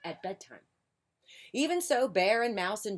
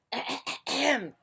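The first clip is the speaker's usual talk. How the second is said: pointed, raised "ahem"; reminding throat-clear; 7 semitones higher, 5 dB louder than usual. A narrator's reading. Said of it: "cleared his throat in a reminding sort of way".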